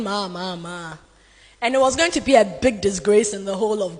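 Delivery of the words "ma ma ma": mock baby-like sobs, dying away; imitated crying. Newscaster retelling the countdown to going on air, mock crying. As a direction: act out crying in little repeated syllables. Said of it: "I was crying".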